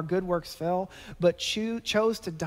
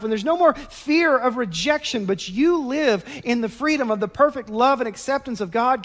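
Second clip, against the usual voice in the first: loud, higher, fervent preacher; impassioned preaching; 5 semitones higher, 6 dB louder than usual. Acted. Preacher urging the congregation they are free, loud and higher. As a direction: lift them up with passion about freedom.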